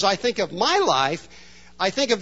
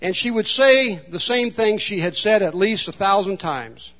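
ordinary talk, nothing marked

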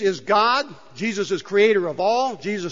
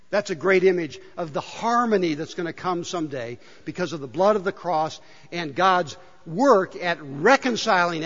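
ordinary talk, nothing marked

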